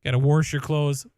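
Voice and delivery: loud "wash" with an R put in, WORSH; warsh for wash. Acted. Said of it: "Warsh is one that".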